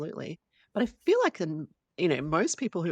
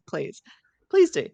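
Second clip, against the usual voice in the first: playful excited; 8 dB louder, slower than usual. Real, not acted. Jokingly urging co-host to take over.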